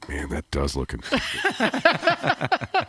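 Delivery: very slow, musing aloud mock concern; pretending at an airport urinal to scare people off, slow mock muttering; talking to yourself. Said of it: "just like you're talking to yourself".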